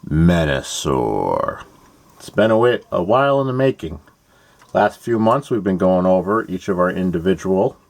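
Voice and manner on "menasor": low, slow, drawn-out announcement; dramatic name reveal. Pretend. Host revealing the awaited robot to fans, low dramatic drawl. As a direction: draw the name out low and ominous.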